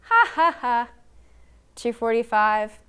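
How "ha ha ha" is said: loud high acted "ha ha ha"; spaced-out mock baby laugh; baby laughter. A narrator's reading. Baby amused by her own yelling, laughing loudly. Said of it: "she laughed at her own yelling".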